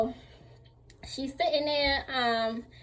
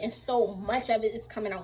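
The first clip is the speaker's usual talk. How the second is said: ordinary talk, nothing marked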